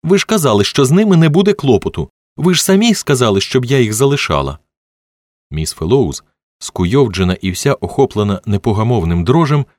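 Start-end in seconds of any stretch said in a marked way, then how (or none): 0.0-4.6 high, upset accusation; indignant, raised-pitch reproach; accusing. A narrator's reading. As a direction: throw the blame back at them. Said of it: "вона раптом перейшла на звинувачення".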